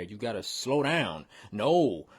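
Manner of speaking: ordinary delivery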